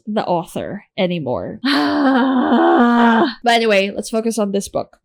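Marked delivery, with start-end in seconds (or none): 1.7-3.4 gasp. Real, not acted. Gasp before refocusing.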